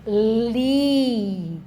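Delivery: "li" said alone, clean and set apart; "li" isolated like a phonics drill; isolated syllable. Shown as a demonstration. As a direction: give "li" as a clear, separate sound. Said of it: "tem o som".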